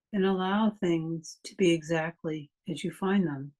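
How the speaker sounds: calm meditation voice with long pauses; guided meditation. Real, not acted. Meditation teacher leading a class through a body scan, calm guided meditation. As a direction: guide them unhurriedly, leaving long silences between phrases.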